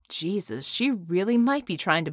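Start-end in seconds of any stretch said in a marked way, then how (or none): none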